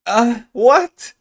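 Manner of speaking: loud high puzzled what; baffled high what. Real, not acted. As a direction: yelp what in confusion. Confused by German word.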